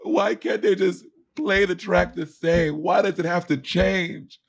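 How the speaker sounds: high, drawn-out mock wail; hammed-up slow drunken sobbing; imitated sobbing. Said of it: "like, sobbing".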